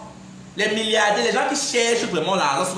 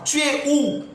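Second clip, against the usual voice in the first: imitating his mother; 7 semitones higher, slower than usual. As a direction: play his anxious mother calling. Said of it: "ma mère m'a appelé cinq fois". (il imite sa mère inquiète au téléphone)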